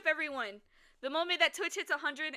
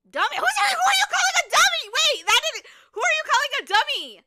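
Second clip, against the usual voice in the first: high, loud playful indignant yell; mock outrage; 12 semitones higher, 14 dB louder than usual. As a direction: pretend to be offended. Mock offense at chatter.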